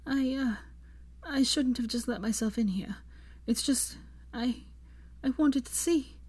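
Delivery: soft worried Hermione telling bad news.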